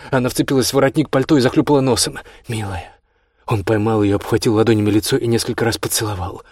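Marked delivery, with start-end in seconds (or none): 2.5-2.9 tender. A narrator's reading. Kostya catching his crying wife, tender. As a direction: say it softly as an endearment.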